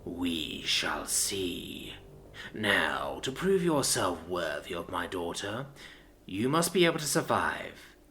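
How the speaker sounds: low, slow, soft menacing voice; slow dread from a looming father; menacing giant. Acted. Voices Mortarion.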